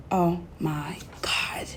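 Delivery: breathy whispered oh my god; whispered aside. Real, not acted. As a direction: whisper it to yourself in disbelief. Aside about relocating.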